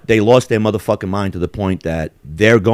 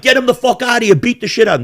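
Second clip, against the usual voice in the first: mock angry crowd; 13 semitones higher, faster than usual. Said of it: "and they're like".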